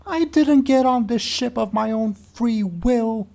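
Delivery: high, loud, slow weasel wail; indignant weasel. Acted. Captive weasel protesting to Bud about the ship, indignant wail. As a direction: protest in a drawn-out high whine.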